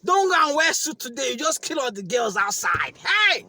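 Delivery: loud, high mirror hype yell; hype shout. Retold or imitated. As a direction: shout praise at yourself, dragged out. Hyping himself in the mirror.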